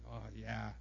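low, subdued brooding; sit on the grudge, quietly; understated resentful grumble; stewing anger. Acted. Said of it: "you just let it sit there".

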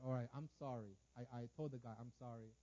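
low, soft, humbled apology; retold sheepish climb-down, low and soft; subdued apology. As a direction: back down quietly. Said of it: "And so I said".

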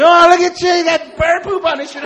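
loud high jeering; ridiculing kids. Retold or imitated. Classmates ridiculing Chin, shouting high. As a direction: taunt him like schoolyard kids. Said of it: "Everybody started ridiculing me".

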